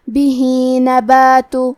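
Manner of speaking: بِهِ read slowly with the final ِهِ stretched to two counts; lengthened vowel. Shown as a demonstration. Teacher demonstrating madd sila to students, stretched بِهِ. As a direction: draw out بِهِ for two beats, slow. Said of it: "اپنی آواز دو مد کے برابر لمبی کریں گے".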